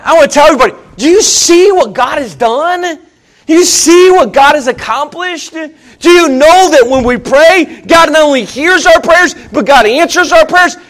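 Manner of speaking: loud, high-pitched pulpit fervor; impassioned preaching. Real, not acted. Preacher testifying of answered prayer, impassioned preaching. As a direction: press each question on the whole congregation.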